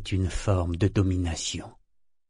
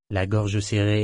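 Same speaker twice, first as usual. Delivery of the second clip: plain reading voice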